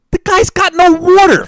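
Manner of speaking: high, slow, disbelieving outburst; incredulous. Real, not acted. To cohost about the waterless tenant, incredulous and high. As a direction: stretch it out in pure disbelief.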